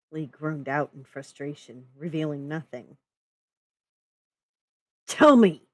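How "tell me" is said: high, loud forceful demand. Acted. Narrator pushing Lee for the truth, loud demand. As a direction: snap the order out. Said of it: "I demanded".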